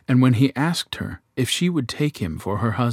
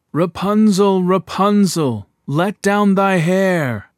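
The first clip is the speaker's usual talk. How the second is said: loud, slow call up to the tower; prince calling out; 9 semitones higher, 6 dB louder, slower than usual. A narrator's reading. King's son copies the witch's call, loud but in his own voice. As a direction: call up to her, loud and drawn out. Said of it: "he went to the tower and cried".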